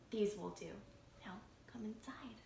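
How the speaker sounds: hushed farmer. Acted. Farmer speaks by woodpile.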